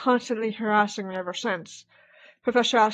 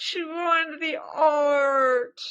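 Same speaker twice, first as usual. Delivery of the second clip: real dismay; 7 semitones higher, much slower than usual. Real, not acted. Player sees ruined artwork.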